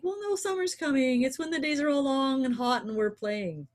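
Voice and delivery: high-pitched imagined objection; mock protest. Listeners objecting that summer is coming, mock protest. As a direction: voice the doubter's thought, pitched well up.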